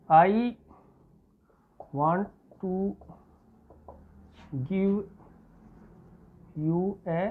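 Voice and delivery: word by word, with long pauses; slow dictation. Shown as a demonstration. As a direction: dictate each word slowly for students to write. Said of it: "यहाँ आप लिखेंगे". (धीरे-धीरे शब्द-शब्द बोलकर लिखवाना)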